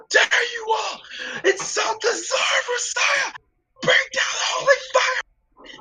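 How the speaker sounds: high, shouted royal tirade; yelling king. Acted. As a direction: scream the insults down at them. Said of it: "as he's yelling".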